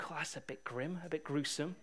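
soft, muttered disgust from the group; hushed "that's a bit grim" aside; quietly squeamish. Retold or imitated. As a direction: say it under your breath, mildly put off. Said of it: "Everyone's going".

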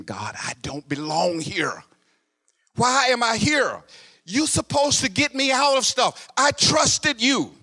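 high, slow, straining cry upward; a man begging heaven to get him out; anguished plea to God. Retold or imitated. Preacher in jail cries out to God, pleading high and slow.

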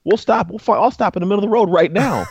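high, eager promise; emphatic promise. Real, not acted. Husband explaining to women he'd stop right away, emphatic. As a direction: insist you'd pull over instantly.

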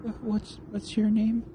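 very high, halting, timid voice; scared captive asking her kidnapper's name; frightened question. Acted. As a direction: stammer it out in fear.

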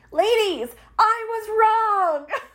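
high, drawn-out cry breaking into laughter; exuberant confession. Real, not acted. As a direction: exclaim it high and loud, laughing. Exclaiming her own confession with laughter.

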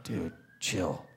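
hushed exasperation. Retold or imitated. Talking to kid, hushed.

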